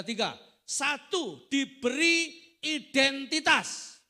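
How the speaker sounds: loud, high, emphatic preacher; full-force preaching. Real, not acted.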